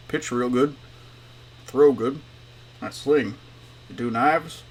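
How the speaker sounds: lightly mumbled janitor voice; a hint of a mumbler, words run loose; Mumbling Jim. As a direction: mumble short, broken phrases. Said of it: "uh, I was mumbling".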